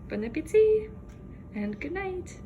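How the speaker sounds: high, loud cheery farewell; a bubbly bon appetit; bright sign-off. Real, not acted. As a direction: sing out a happy goodnight.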